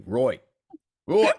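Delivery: put-on "Roy" said with the vowel of "boy", as Cockney "right"; "Roy" for "right", rhyming with "boy"; Cockney pronunciation. Shown as a demonstration. Showing how a Cockney says "right". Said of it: "where R I G H T is pronounced R O Y T".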